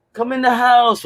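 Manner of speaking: put-on high call in a Costa Rican mom's accent. Man voicing his mom calling him in, Costa Rican accent. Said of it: "with her little Costa Rican accent".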